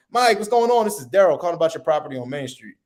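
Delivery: high bright start on Mike, then energetic and fast; playful energetic. Shown as a demonstration. Salesman calling seller, playful energetic greeting. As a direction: greet him like an old friend. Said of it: "playful tone and an energetic tone".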